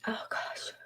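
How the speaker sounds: whisper. Real, not acted. Overcome with emotion at a reading.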